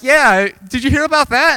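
loud, high-pitched busybody; put-on excited gossiper; eager gossip. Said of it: "so they're like".

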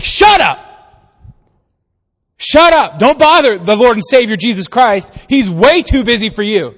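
loud, high mock rebuke; hammed-up scolding shout; acted angry shout. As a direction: yell him down as the annoyed crowd. Crowd rebuking the blind man.

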